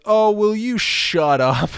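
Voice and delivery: slow, drawn-out exasperated "Oh, will you shut up"; a lightly voiced, fed-up Biden, slowed right down; Biden telling Trump to shut up. Biden snapping at Trump mid-debate, slow exasperated jab. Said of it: "Biden goes".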